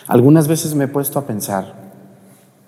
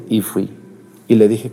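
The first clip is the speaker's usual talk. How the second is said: usual speaking voice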